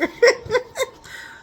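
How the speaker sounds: a high real laugh; laughing in disbelief at them. Real, not acted. Laughing at the people who changed their tune.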